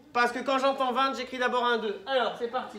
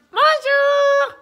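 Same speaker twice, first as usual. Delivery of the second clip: silly clown; 11 dB louder than usual. Acted. Poupoule greets the class again.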